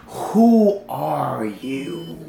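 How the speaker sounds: very slow, drawn-out "who are you"; slow, deliberate probing; pressing question. Acted. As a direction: stretch each word out to press the question home. Pressing someone for a real answer.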